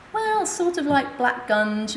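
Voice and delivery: describing her stool to handsome doctors, awkward and slow; hesitant describing. Retold or imitated. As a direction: drag it out, embarrassed.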